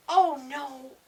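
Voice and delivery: loud, high wail of dismay; dismayed cry. A narrator's reading. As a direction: cry out at the damage. Dismay after being knocked off bike.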